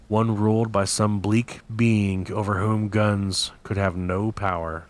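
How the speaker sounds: plain reading voice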